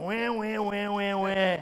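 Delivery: mock crybaby wah-wah, a touch of a laugh; lightly mocked baby crying; mock whining. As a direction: whine it like a sulking child. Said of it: "all that I've done".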